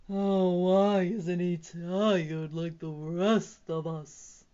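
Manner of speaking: slow, loud bear's moan; drawn-out moan of a tired bear. A narrator's reading. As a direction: drag it out in a weary complaint. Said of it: "moaned the bear".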